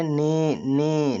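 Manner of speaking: ने held long on the vowel; isolated syllable. Shown as a demonstration. Teacher checking with students, ने sounded out long. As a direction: stretch ने out by itself. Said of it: "क्या बोले?".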